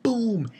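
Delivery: sharp, high imitated impact; high boom effect. Sound effect of the hit.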